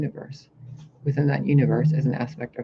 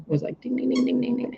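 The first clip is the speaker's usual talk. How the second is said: bell imitation; 10 semitones higher than usual.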